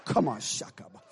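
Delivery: ordinary talk, nothing marked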